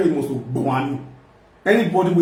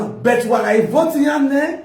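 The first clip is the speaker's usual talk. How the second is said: shouting; 7 semitones higher, slower than usual. Real, not acted. Heated rant at voters.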